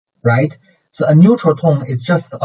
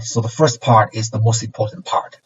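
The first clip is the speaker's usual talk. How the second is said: ordinary delivery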